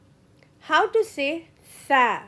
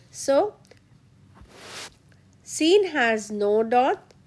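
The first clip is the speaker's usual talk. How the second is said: ordinary delivery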